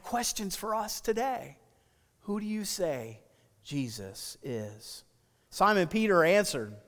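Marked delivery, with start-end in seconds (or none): 2.2-5.0 quiet, slow, lower on "Jesus is"; hushed question. Shown as a demonstration. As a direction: ask it gently, slowing to a hush. Preacher poses question to congregation.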